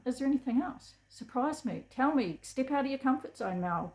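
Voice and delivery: ordinary delivery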